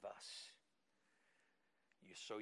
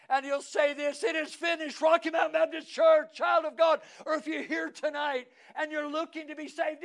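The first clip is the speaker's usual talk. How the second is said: very high, very loud pulpit shout; shouted preaching climax; 13 semitones higher, 17 dB louder than usual. Acted. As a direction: cry it out to the whole church.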